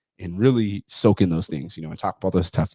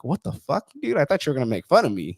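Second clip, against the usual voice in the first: retold surprise; 7 semitones higher than usual. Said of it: "And I'm like".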